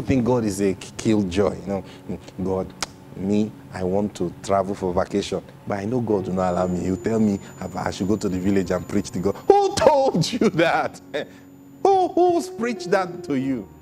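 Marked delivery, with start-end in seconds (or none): 2.4-9.4 low, mildly put-on resignation; lightly mocked defeatist complaint; resigned believer. Mimics believer resigned to no vacation. 9.5-13.7 high-pitched incredulous challenge; drawn-out, scandalized high question; indignant disbelief. Acted.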